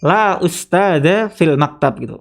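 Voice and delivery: "la ustadza fil maktab" said slowly; slow model sentence. Shown as a demonstration. Teacher models the Arabic answer slowly for students. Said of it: "Kita ngomongnya".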